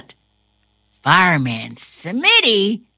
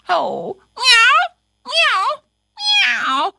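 high mewing calls; cat meows; 4 dB louder than usual. Acted. Cat searches for the dog, high mewing. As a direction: mew out searching.

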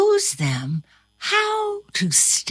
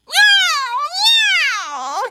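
cat meows; 4 dB louder than usual. A narrator's reading. Cat calls out in chase.

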